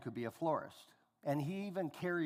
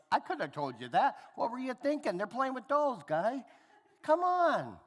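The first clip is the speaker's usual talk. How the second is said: much higher and louder mock exasperation; rising up to a loud, high "come on" at a bored son; exasperated dad; 10 semitones higher, 7 dB louder than usual. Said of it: "I'm like".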